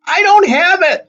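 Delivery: slow, very high, loud protest; panicked shout. A narrator's reading. Percy protests amid the panic.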